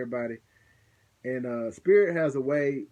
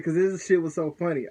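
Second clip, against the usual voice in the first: ordinary delivery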